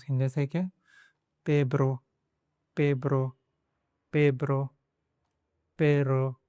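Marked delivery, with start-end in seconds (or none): 1.5-6.3 पेब्रो eased into पेरो with a tapped r; dropping the b. Shown as a demonstration. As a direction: drill पेब्रो until it becomes पेरो. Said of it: "इसको निकालिए बे को". ("पेब्रो" से "ब" हटाकर "पेरो")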